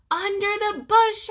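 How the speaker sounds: high, loud squeaky yell; top-of-tiny-lungs yell. A narrator's reading. Trapped hamster directs searchers to him, yelling. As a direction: shout with all the might of a tiny creature. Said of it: "I yelled at the top of my tiny lungs".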